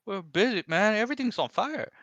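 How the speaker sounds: very high, loud squeaky mock voice; frazzled and silly from no sleep; [name]'s exhausted voice. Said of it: "why are you talking like that?".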